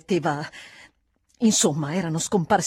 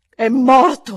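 loud, higher, forceful disclosure; dramatic revelation; 5 semitones higher, 13 dB louder, slower than usual. A narrator's reading. Housekeeper at last reveals he is dead, loud and stark. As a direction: throw out the dreadful news.

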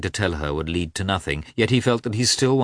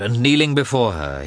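ordinary delivery, nothing marked

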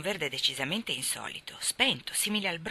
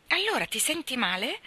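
worried question; 7 semitones higher than usual. Acted. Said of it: "subito preoccupata".

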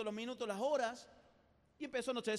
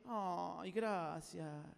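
agradecimiento enternecido; 5 semitones lower, much slower than usual. Acted. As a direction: melt at the insult as if flattered. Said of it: "vos le decís". (gracias con ternura fingida)

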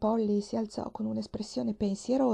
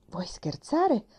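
incredulous; 4 semitones higher, much slower than usual. A narrator's reading. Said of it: "borbottò Tom incredulo".

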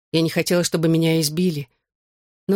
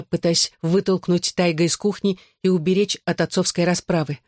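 ordinary delivery

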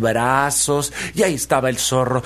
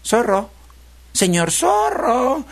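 high, lengthening piglet call; 11 semitones higher, much slower than usual. Acted. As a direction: call for someone who isn't answering, rising higher. Piglet calls the unresponsive fox. (cerdito llamando con voz aguda)

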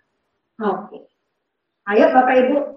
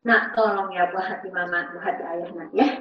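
put-on soft, hushed coaxing of a beloved child; quiet, tender parent's request; tender plea to child; 9 semitones lower, 6 dB quieter than usual. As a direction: plead gently like a mother to her little one. Mother pleading softly with child.

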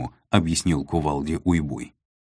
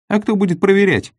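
high, loud sincere surprise; genuinely baffled question; 8 dB louder than usual. A narrator's reading. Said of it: "искренне удивился Копыто".

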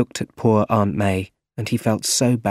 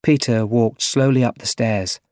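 ordinary delivery

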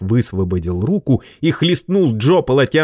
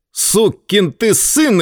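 much higher, slow furious curse; slow, high angry bark; 11 semitones higher, 3 dB louder, much slower than usual. Acted. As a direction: spit out the insult in rage. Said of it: "рявкнул Джо".

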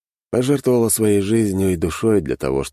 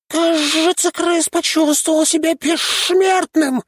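very high, slow creature voice; sneering panto villain; 4 dB louder, slower than usual. A narrator's reading. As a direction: sneer like a cartoon imp. Shnyrka voice from thin air.

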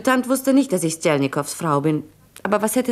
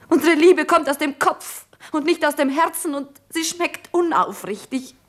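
higher-pitched, troubled outpouring; agitated lament; 5 semitones higher than usual. Acted. Woman lamenting to Jura about insincere love, agitated. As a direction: let the distress lift the pitch.

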